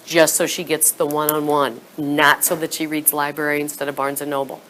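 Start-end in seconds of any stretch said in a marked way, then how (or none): none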